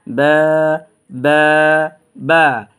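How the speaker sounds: বা with both lips, drawn one alif long; letter ba. Shown as a demonstration. Teacher drilling students, lip-formed বা. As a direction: drill বা three times from the lips. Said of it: "দুই ঠোঁটের বিজা অংশ থেকে উচ্চারণ করব".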